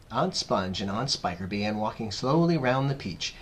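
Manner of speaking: ordinary delivery, nothing marked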